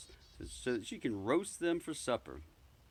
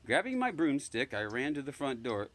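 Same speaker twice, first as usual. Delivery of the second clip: plain reading voice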